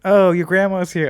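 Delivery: loud, high imitation of teasing classmates; mock classmates' call. Retelling classmates at the classroom, mock loud call. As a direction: call it out like a kid announcing a visitor.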